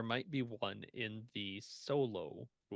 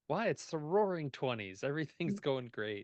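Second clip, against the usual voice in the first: sarcastic; 4 semitones higher, 10 dB louder than usual. Pretend. Sarcastic joke about the twenties.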